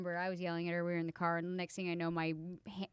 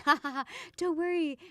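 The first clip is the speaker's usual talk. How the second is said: higher, imitated chuckling reassurance; mock knowing laugh; 5 semitones higher than usual. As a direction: imitate other moms laughing it off. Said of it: "they're like".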